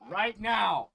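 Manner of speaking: loud, high, excited burst; excited shout. Real, not acted. Excited about putting jacket on.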